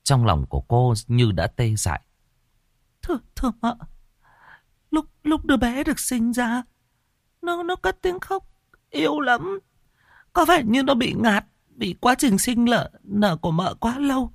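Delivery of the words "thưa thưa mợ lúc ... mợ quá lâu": high-voiced, tearful apology; sobbing maid. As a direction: plead and sob at once. Maid recounts the birth.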